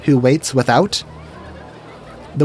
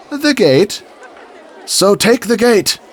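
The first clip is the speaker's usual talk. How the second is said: knight explaining; 8 semitones higher, slower than usual. Retold or imitated. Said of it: "the skit goes something like this".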